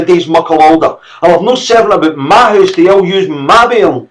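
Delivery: high, loud furious Scots mother; screaming rage. A narrator's reading. Mother screaming at the servant who hit her son. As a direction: tear into the servant for her bairn. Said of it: "she screamed".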